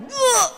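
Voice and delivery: high, drawn-out horrified "ugh"; inner shock. Retold or imitated. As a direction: act out a silent panic aloud. Inner shock at child's remark.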